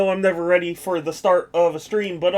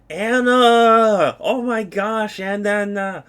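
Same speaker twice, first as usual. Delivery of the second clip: excited greeting; 3 semitones higher, 4 dB louder, much slower than usual. Real, not acted. Greeting a returning viewer.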